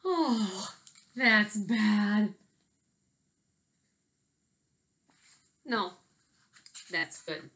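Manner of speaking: lively picture-book read-aloud with big swings; reading to children. Acted. Teacher reading a picture book to her class, lively. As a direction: tell it like a story hour for kids.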